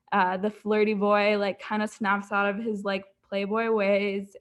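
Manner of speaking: ordinary talk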